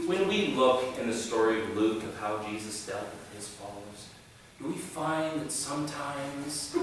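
mock soothing sermon voice; kind evangelical pastor. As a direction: ease into it like a gentle pastor. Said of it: "they're much, much kinder about it".

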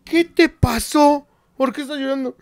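concerned friend. Retold or imitated. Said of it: "me dice".

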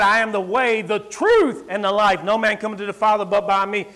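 loud, ringing scripture quote; booming gospel proclamation; preaching Jesus' words. Acted. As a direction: proclaim it to the church.

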